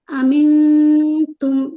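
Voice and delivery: अमिन तुम with the न hidden in the nose, held two beats; drawn-out nasal न in अमिन, slow and chanted; nasal ikhfa demo. Shown as a demonstration. Teacher demonstrating ikhfa to students, slow nasal hold. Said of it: "यहाँ पर दो हरकत लंबा करेंगे".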